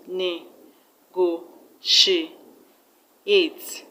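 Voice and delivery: "Ne-go-she-ate" very slowly, a gap after each part; teacher splitting "Ne-go-she-ate" into syllables. Shown as a demonstration. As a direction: count out "Ne-go-she-ate" in four separate beats. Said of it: "It has four syllables".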